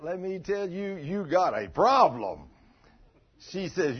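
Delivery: ordinary delivery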